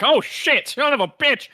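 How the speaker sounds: high, loud burst of alarm; startled yelp. Acted. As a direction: yelp like he just got burned. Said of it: "and he's like".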